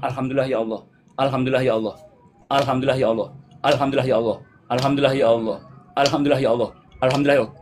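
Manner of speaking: low, steady murmured litany; inward dhikr. Shown as a demonstration. Teacher shows dhikr kept going in the heart, low recitation. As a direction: repeat it low, as if only in the heart. Showing dhikr repeated in the mind.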